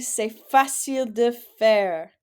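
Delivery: teacher asking class to repeat after her; model phrase. Shown as a demonstration. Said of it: "répétez après moi".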